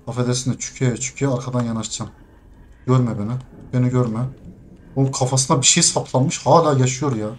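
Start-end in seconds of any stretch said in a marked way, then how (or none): 0.1-4.2 lower, softer, quick sneaking talk; sneaky whisper-like hush. Real, not acted. Gamer sneaking up behind an enemy, hushed and quick. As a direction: tiptoe through the words.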